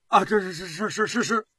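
rapidly repeated, cowed assent; flustered, grovelling yeses; nervous stammer. A narrator's reading. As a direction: stammer out submissive agreement. Terrified official stammers assent under interrogation.

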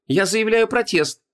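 high, slow, huffy protest; indignant protest. Acted. Heroine protesting.

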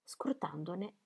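hushed trailing whisper; narrator whisper. A narrator's reading. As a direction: breathe the last words. (sussurro del narratore)

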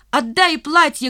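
высокий, протяжный окрик; крикни, требуя вернуть; требовательный крик. A narrator's reading. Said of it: "крикнул Димка". (требовательно кричит)

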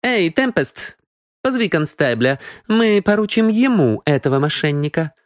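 high-pitched woman's order; commanding woman. Acted. Мисс Киан sends Темпест for the constable, commanding.

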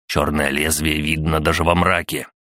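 ordinary delivery, nothing marked

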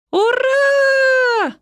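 very high, loud, drawn-out hooray; gleeful cheer. Acted. Yael runs free to the narrator, gleeful cheer. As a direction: let out a long high squeal of joy. Child cheers on being released.

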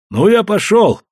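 sudden high-pitched announcement; abrupt announcement. A narrator's reading. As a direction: announce it out of the blue. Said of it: "вдруг сказал Иван".

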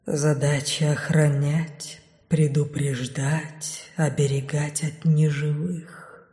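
slow, low, fading whisper; dark whisper behind you. A narrator's reading. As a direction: whisper it like a dying breath. Shadows answer her. (тёмный шёпот за спиной)

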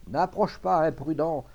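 urgent command to keep away. A narrator's reading. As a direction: order the man back sharply. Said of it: "il commanda".

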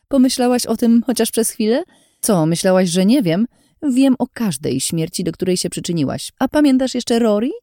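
heated, bitter confrontation; angry tirade. A narrator's reading. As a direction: hurl accusations at someone you resent. Ines furiously berates the heroine.

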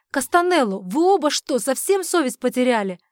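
loud, high outraged scolding; exasperated shouted reproach; indignant outburst. Acted. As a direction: raise the voice in outrage. Outraged protest in a scuffle.

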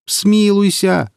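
high, drawn-out wail for mercy; pleading cry. A narrator's reading. Tormented merchant begging his rescuer, pleading cry. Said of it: "кричит Марек".

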